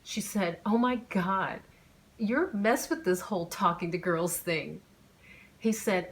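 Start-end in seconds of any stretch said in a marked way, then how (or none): 0.6-4.8 laughing. A narrator's reading. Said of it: "she was laughing".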